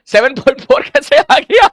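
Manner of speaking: loud, slow disbelieving question; incredulous scolding. Real, not acted. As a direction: demand how they got it wrong. Teacher scolding wrong answer. (ज़ोर से, धीरे, हैरानी भरी डाँट)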